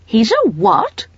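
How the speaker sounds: can't believe her ears; shocked. Acted. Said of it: "in a shocked voice".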